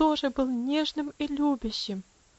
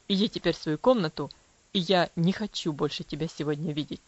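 low, cold father's voice; coldly; 5 semitones lower, 4 dB louder than usual. Acted. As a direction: answer her icily, pitched low. Father rebukes daughter. (холодно, низким голосом отца)

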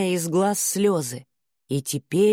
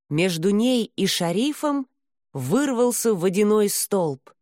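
ordinary delivery

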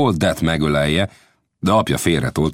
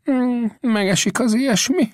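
higher, wry frontiersman voice; a doubting old scout, pitched up; Old Death's voice. Acted. Old Death answers the narrator with doubt.

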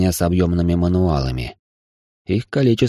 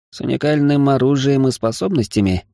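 higher-pitched, wary question; distrustful; 7 semitones higher than usual. A narrator's reading. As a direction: ask it with mild suspicion. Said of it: "недоверчиво прищурился Адейн".

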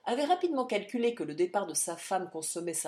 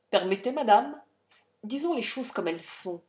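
harsh mocking; 3 semitones higher, 5 dB louder than usual. A narrator's reading. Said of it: "une voix dure et railleuse".

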